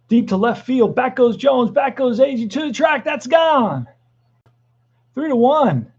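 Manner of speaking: home run call. Acted. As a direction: climb in pitch as the ball flies out. Calling a home run live.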